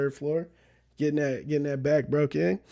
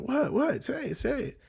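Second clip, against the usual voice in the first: put-on anxious boyfriend; 8 semitones higher, 6 dB quieter than usual. Boyfriend's anxious demand in retold prank.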